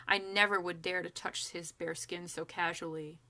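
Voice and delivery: plain reading voice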